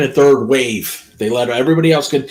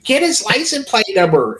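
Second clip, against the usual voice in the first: high-pitched mock officer order; pitched-up quote of the police; imitated officers; 8 semitones higher than usual. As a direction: imitate an indignant cop giving an order. Voices the officers' reaction.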